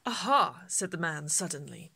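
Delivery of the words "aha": loud bright "Aha"; sudden idea. Acted. Shopkeeper suddenly thinking of a hat for Millie, loud eureka.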